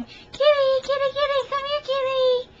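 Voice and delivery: high, loud calling of a pet; yelling for cat. Retold or imitated. Woman calling her nameless cat around the neighborhood, yelling. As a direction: call out high and loud across the street.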